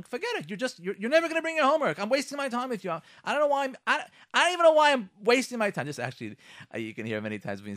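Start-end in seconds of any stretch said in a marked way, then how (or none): 0.0-5.9 high-pitched fed-up parent; acted exasperation. Parent to child over forgotten homework, acted exasperation. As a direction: give up on them in rising frustration.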